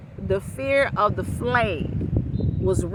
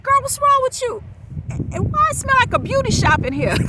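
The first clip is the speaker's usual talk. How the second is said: sassy friend. Retold or imitated. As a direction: tease her about the burnt smell. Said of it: "she says".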